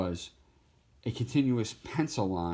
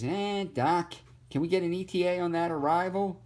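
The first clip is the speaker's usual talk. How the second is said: high Brooklyn cartoon rabbit; wisecracking Bugs Bunny Brooklyn accent; 9 semitones higher than usual. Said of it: "Bugs Bunny".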